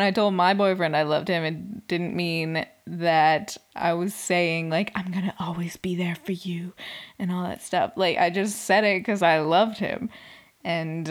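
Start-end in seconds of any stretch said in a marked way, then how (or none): 4.9-6.7 whispered quote. Retold or imitated. Quoting grand promise.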